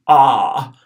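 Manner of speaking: "ah" held long and loud. Shown as a demonstration. Teacher showing the long Latin a, held out. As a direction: draw out a long open "ah". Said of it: "second A long".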